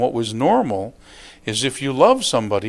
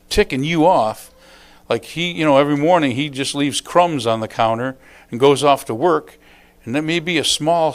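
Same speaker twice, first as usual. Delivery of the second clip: normal talking voice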